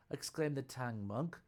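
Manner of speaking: usual speaking voice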